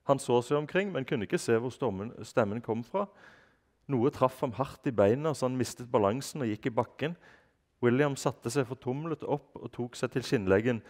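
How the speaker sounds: put-on reading a children's book aloud in Norwegian; story narration. A narrator's reading. Reading a chapter aloud to listeners, lively narration. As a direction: tell the story to young listeners.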